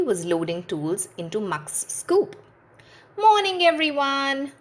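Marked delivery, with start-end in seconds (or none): 3.2-4.5 higher, bright call to the team; cheery Bob greeting. A narrator's reading. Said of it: "said Bob".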